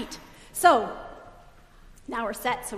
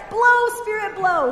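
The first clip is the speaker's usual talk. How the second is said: called out loud and higher; 6 semitones higher, 9 dB louder, slower than usual. Shown as a demonstration. Pastor calling into the fan for Emery, loud. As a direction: ring it out loud. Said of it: "say it really loud".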